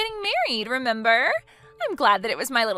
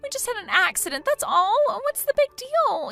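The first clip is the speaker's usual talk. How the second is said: high, loud anguished cries; anguished pleading; 11 semitones higher, 4 dB louder than usual. Acted. Jilted bride blaming sister-in-law, anguished high cries. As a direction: plead and blame through heartbreak.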